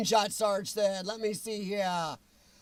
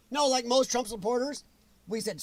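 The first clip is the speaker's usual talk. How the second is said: normal talking voice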